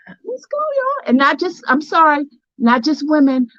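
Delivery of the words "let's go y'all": high, hyped rallying call; excited cheer. Real, not acted. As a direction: fire up the crowd. Rallying the viewers.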